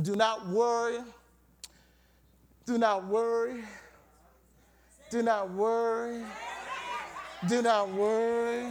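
measured, insistent sermon chant; steady preacher's refrain; preached refrain. Real, not acted. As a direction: hammer the commandment evenly, holding the last word. Preaches a repeated commandment.

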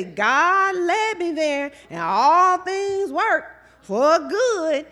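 loud, slow mock-holy pronouncement; preacher hamming up a churchgoer's holy excuse, loud; mock pious excuse. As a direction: ham up the pious excuse, full-voiced.

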